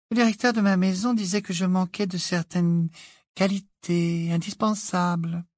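high, light mock-solemn voice; cheerful young witch. A narrator's reading. Witch explaining why she was never chosen, light-hearted. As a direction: recount it in a high, easy voice.

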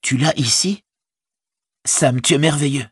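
high-pitched amazed relief; astounded. A narrator's reading. Hobbit praising his servant for saving the ring, amazed. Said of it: "demanda Frodo ahuri".

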